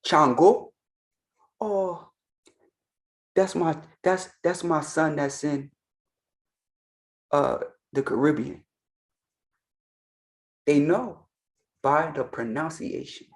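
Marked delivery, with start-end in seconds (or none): 1.6-8.6 put-on softer, fond mother goddess recognizing her child; lightly voiced goddess, gentle and knowing; knowing divine mother. Yemaya recognizing the child calling her, softer fond voice. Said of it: "If somebody says Chango".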